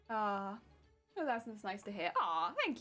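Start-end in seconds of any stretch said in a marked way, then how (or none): none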